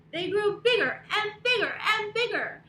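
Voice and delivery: loud and high, swelling with each bigger; excited building. A narrator's reading. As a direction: grow bigger with every word. Storytime build, kids.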